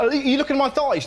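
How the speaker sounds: a mock-suspicious mum voice. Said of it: "my mum's like".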